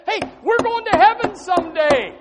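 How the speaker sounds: high, loud and slow proclamation; shepherds calling out. Retold or imitated. Shepherds shouting the good news to the crowd, loud and high. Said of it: "they're trying to tell you".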